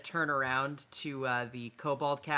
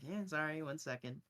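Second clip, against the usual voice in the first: embarrassed; 8 dB quieter than usual. Acted. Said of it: "kind of embarrassingly".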